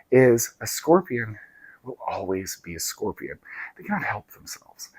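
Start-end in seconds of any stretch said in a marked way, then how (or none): 1.8-4.9 hushed whisper of the moral; soft confiding whisper. A narrator's reading. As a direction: breathe it like a secret. Moral of fable, dropped voice.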